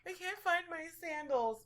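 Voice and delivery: high, loud comic whine; mock meltdown. Imagined pregnant woman missing her sandals, mock tearful wail. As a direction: whine as if it were a tragedy.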